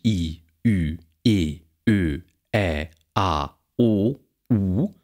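long, tense vowels I, Ü, E, Ö, Ä, A, O, U held out one by one. Shown as a demonstration. Lecturer demonstrating long tense vowels to students. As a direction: sound out each long tense vowel slowly. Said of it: "zu jedem dieser gespannten Vokale".

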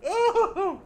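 high, loud startled oh; jump-scared cry; real shock; startled yelp. Real, not acted. Just got jump-scared.

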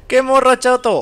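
high, loud excited outburst; sudden gleeful cry; excited exclaim. Real, not acted. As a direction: blurt it out thrilled at the screen. Excited reaction to game moment.

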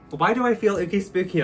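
high, loud, campy; camp spooky shout. Acted. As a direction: belt it with camp glee.

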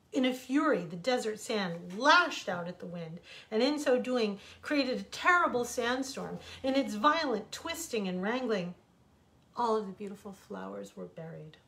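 ordinary delivery, nothing marked

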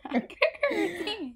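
laughing while saying it; words through a giggle. Real, not acted. Repeats rating through laughter.

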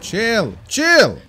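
escalating, high-pitched plea; urgent calming call. Real, not acted. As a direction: call out to settle an angry beast. Calming an angry game creature.

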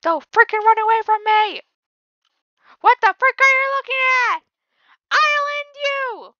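high, loud, hammed-up screaming at zombies; mock-furious high holler; imitating meme yelling. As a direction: yell like the man cursing at cats. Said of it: "yelling at freaking cats, cursing at them".

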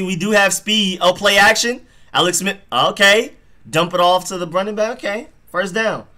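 high, loud rising hype; real fan hyped at the screen; excited live reaction. Real, not acted. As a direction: call the play as it breaks open.

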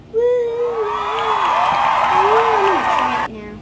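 high, loud and slow hype; excited shout. Real, not acted. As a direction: shout it out like a show host. Excited cooking show tasting.

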